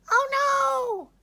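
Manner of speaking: tiny beetles shrieking in high terror. A narrator's reading. Timid beetles fleeing the spider, high shriek. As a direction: squeal it out, high and loud. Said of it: "they shrieked".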